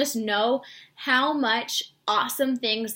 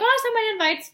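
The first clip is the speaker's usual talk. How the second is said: much higher, pleased with herself; chirpy self-satisfied quip; imitating her old naive self; mock pride; 12 semitones higher, 4 dB louder than usual. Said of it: "And I'd be like".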